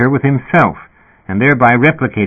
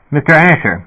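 higher-pitched official address; lightly voiced dispatcher, pitched up; sputtering intercom speaker; 6 semitones higher, 3 dB louder than usual. A narrator's reading. Dispatcher addressing the man with the gun, sputtering. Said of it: "the speaker sputtered".

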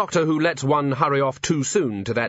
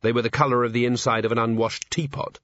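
plain reading voice

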